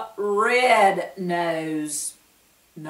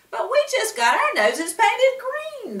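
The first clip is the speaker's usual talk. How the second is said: much higher, whiny complaint; high protest; 4 dB louder than usual. A narrator's reading. Friends protesting to the shopkeeper, high whiny voice. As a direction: squeak out the protest.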